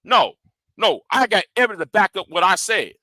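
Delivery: normal talking voice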